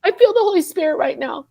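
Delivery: high, quickened, tearful voice; overcome, hurrying through real tears; really emotional. Real, not acted. Feeling the Holy Spirit while testifying, overcome with emotion. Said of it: "I don't know why I'm getting so emotional".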